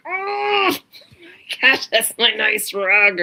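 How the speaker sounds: high, loud dismayed whine over spilled milk; frustrated groan. Retold or imitated. Parent groaning when the child spills milk on the nice rug. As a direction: wail at the ruined rug like it's a catastrophe. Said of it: "immediately you're like".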